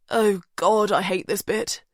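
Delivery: mild queasy moan. A narrator's reading. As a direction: complain with a touch of nausea. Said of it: "Julian moans".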